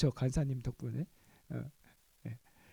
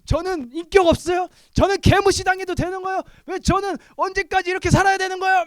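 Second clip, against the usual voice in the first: much higher, loud, outraged whine; furious complaint; 14 semitones higher, 13 dB louder than usual. Retold or imitated. Pastor loudly venting at God in prayer, outraged. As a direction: rage at God, fed up and hurt.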